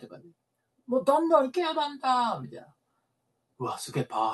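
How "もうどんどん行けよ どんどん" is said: 高めの声でけしかけるセコンドの真似; 前に出ろと選手をあおる; けしかけるセコンド. Retold or imitated. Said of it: "違う違う違う". (選手を前に出させるセコンドの真似、高い声で)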